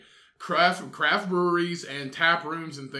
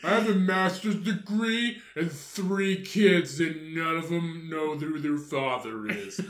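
put-on drunk woman voice; slower than usual. Comic drunk woman persona.

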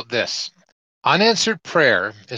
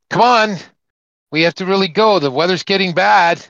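raised urgent call to come aboard; 7 semitones higher, 5 dB louder than usual. Acted. Boat rescuer shouting to stranded man over storm, urgent call.

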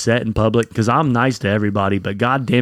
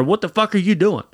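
higher-pitched retold angry challenge; pissed off; 7 semitones higher than usual. Man confronting the driver who nearly hit them, pissed off. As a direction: replay an indignant demand, pitched up. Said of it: "I was so pissed off".

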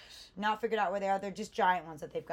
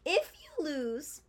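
a cracked high voice; 9 semitones higher, much slower than usual. Real, not acted. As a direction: voice cracking on the words. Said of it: "excuse me, voice crack".

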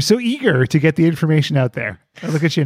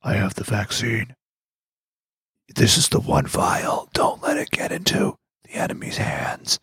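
urgent whisper; secret agent whisper; 3 semitones lower, 4 dB quieter than usual. Retold or imitated. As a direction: hush it like a spy. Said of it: "Jimmy Pfizer was like".

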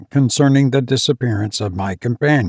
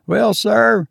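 much higher, triumphant servant's voice; high triumphant steward; 11 semitones higher than usual. Acted. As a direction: crow it like a steward proved right. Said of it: "continued Robert triumphantly".

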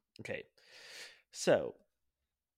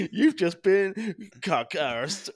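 loud, high, slow triumphant gloat; 10 semitones higher, 11 dB louder, much slower than usual. Pretend. Gloats over the prank.

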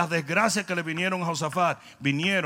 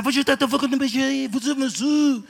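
higher, louder mock French accent; Pepe Le Pew; 5 semitones higher, 5 dB louder than usual. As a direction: ham up a cartoon French skunk. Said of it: "se me parecía a Pepe Le Pew". (acento francés de caricatura, más agudo)

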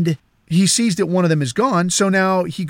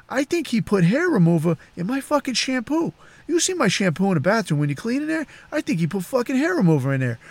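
quick, higher, hushed-down gossip; confiding boss; 5 semitones higher, 4 dB quieter than usual. Retold or imitated. Boss feeds housekeeper a false suspicion, quick confiding voice.

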